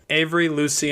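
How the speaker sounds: ordinary delivery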